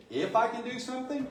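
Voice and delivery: high, disbelieving throw-back of the question; incredulous echo. Retold or imitated. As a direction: repeat his words back in disbelief. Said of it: "And Jesus goes".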